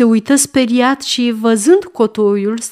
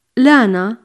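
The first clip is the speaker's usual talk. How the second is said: ordinary delivery, nothing marked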